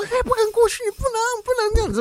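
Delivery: very high voice stopping a pet; calling to a dog. Retold or imitated. Blocking the beagle from the snake, high squealed command.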